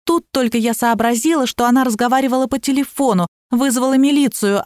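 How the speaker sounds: usual speaking voice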